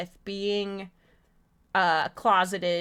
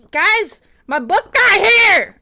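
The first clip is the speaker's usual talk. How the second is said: high, loud, drawn-out yell; excited shout; 10 semitones higher, 13 dB louder, slower than usual. Real, not acted. Streamer announcing the book's arrival to viewers, excited shout. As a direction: shout the big news at the top of your voice.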